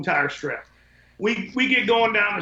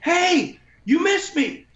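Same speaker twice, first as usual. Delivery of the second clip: high-pitched, louder calling-out; imitated hollering; 11 semitones higher, 4 dB louder, much slower than usual. Card store woman hollers after the window cleaners, imitated hollering. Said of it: "starts hollering at us".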